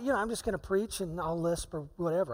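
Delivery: ordinary talk, nothing marked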